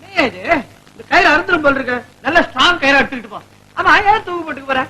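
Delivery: usual speaking voice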